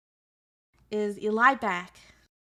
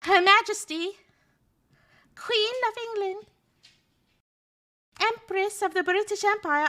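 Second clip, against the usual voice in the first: much higher, louder pompous announcement; mock royal herald; 11 semitones higher, 6 dB louder than usual. Streamer presenting the Queen to chat like a herald. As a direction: proclaim her like a court herald.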